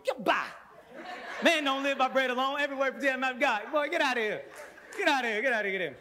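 high, loud laughing get outta here; shooing dad. Retold or imitated. Father shooing his hungry son away during prayer, loud and laughing. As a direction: wave the boy off, half laughing.

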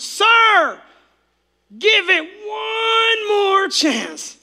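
high, slow, begging plea; pleading gardener. Acted. Gardener begging the owner to spare the fig tree. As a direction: beg the master for mercy.

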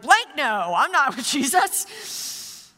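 hammed-up final denial, stretched out with exasperation; emphatic cursing denial. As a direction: play an indignant, censored swearing protest. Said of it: "has to add a curse word to it".

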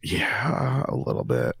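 a low breathy whisper. Real, not acted. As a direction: whisper it reluctantly. Whispered reluctant answer.

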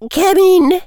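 high, loud, crisp summons. A narrator's reading. Professor answering a knock at her office door, crisp high call. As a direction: call it out crisply, pitched well up. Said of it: "she said in a crisp voice".

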